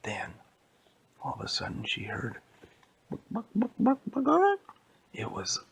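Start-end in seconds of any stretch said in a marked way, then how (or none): 3.1-4.8 high, loud robotic buck buck; electronic chicken clucking. Retold or imitated. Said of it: "electronic chicken sound".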